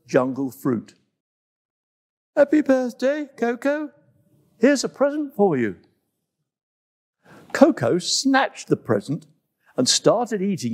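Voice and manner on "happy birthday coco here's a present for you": high, friendly greeting voice; a gentle antelope offering a gift; lightly voiced Antelope. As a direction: sing out a cheerful greeting.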